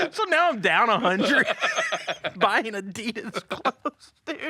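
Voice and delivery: high laughing, dragging the words out; cracking up at his own bad luck. Real, not acted. Telling the host about the tennis outfit, laughing.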